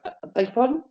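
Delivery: mock disbelief. Wrestler hearing he's booked, mock startled. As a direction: feign shock at the news. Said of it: "I was like".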